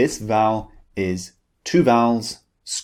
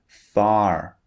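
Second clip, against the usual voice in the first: far with the final R pronounced; American English far, R audible. Shown as a demonstration. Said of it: "This is the American pronunciation".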